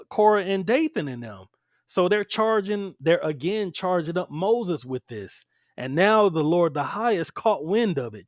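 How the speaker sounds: ordinary delivery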